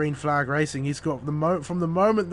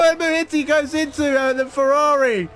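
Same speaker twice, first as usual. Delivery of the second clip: high, loud excited play-by-play; motorsport commentator; 11 semitones higher, 9 dB louder than usual. Acted. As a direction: shout the wheel-to-wheel action. Side by side battle call.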